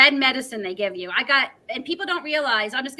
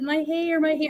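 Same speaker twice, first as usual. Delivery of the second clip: retold crying; 6 semitones higher than usual. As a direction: re-enact a tearful lament in a raised pitch. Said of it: "sitting on the bottom of the tub crying".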